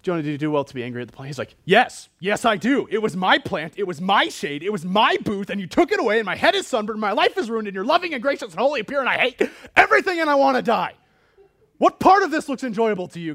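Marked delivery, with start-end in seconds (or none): 0.0-1.3 rattled-off question as God; rapid recap. Acted. Recaps God's question before Jonah's outburst. 1.6-13.3 high, loud, hammed-up tantrum; freaking-out Jonah. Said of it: "he just starts freaking out here".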